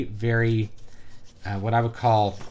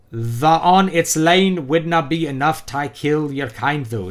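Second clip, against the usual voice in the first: slow, raised, laboured brogue; Irish accent; 4 semitones higher, 6 dB louder, slower than usual. Acted. Reviewer reads out baffling comic dialogue, in a heavy Irish accent. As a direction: sound out the phonetic Irish dialect loudly. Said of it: "characters that are like this".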